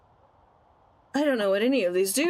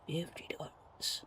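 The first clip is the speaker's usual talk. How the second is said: whispered shocked price; 5 semitones lower, 15 dB quieter than usual. Real, not acted. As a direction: breathe the price out quietly.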